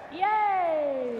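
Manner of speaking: long drawn-out cheer; praising cheer. Real, not acted. Trainer rewarding dog for the retrieve, cheering. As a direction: cheer the dog on, stretching the word.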